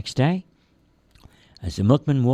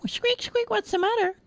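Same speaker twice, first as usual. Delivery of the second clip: very high, tiny mouse squeak; squeaky mouse. Acted. Little mouse calls to the lonely teddy bear, very high squeak.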